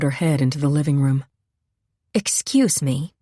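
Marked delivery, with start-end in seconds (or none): 2.1-3.1 timid. A narrator's reading. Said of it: "Her voice was different".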